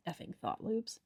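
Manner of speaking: soft grumbling "effing thought loops" under the breath; muttered aside. Real, not acted. Teacher on anxious viewers' thought loops, muttering.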